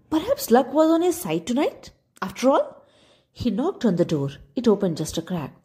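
plain reading voice

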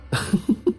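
high, short giggle; girl's laugh. A narrator's reading. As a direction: give a little high laugh. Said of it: "हंसते हुए बोलती है".

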